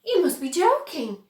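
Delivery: can't believe his ears; disbelief. A narrator's reading. Grandpa Joe doubting Wonka's offer, incredulous.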